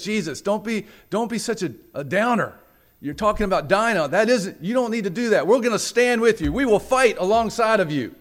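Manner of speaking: high, loud, eager pep talk; rally him with loud, urgent conviction; impassioned pleading, pitched high; fervent protest. Retold or imitated. Peter urging Jesus to fight.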